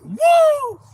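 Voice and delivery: a very high, loud whoop; whooping cheer. Retold or imitated. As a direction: shriek with joy at the catch. Retold celebration.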